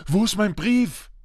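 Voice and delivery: high, urgent question; lightly voiced boy. A narrator's reading. Boy confronting uncle about his letter, high urgent voice.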